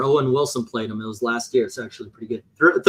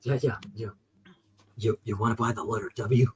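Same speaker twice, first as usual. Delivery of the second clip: softer, lower, sly seller's voice; Sesame Street trench coat guy; 5 semitones lower, 11 dB quieter than usual. Retold or imitated. Host imitating the Sesame Street letter seller, softer and lower. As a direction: hiss the offer like a shady salesman. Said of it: "from Sesame Street".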